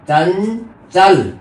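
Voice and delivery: slow "चंचल" with a long held nasal; nasal ञ demonstration. Shown as a demonstration. Teacher showing how it is pronounced, "चंचल" slowly split. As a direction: draw out चञ in "चंचल", then add चल. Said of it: "ऐसे उच्चारित होगी".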